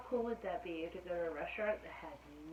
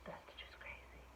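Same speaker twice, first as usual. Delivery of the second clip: hushed amazed whisper; 10 dB quieter than usual. Real, not acted. Whispered aside over picture.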